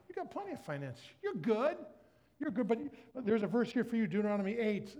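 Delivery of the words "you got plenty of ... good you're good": dismissive. Retold or imitated. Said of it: "you're like".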